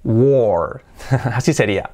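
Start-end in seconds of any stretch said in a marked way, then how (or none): 0.0-0.8 "war" as low, drawn-out "wor"; long vowel. Shown as a demonstration. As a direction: say "war" with the o of door. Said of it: "Alargando más el sonido vocal". ("war" con o larga, como door)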